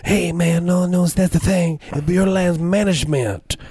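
high mock voice, a patient "no, no, that's the thing"; imitating someone explaining. Telling the confused militia what BLM means, mocking high voice. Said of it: "Then they were told".

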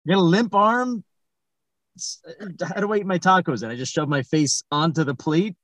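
ordinary delivery

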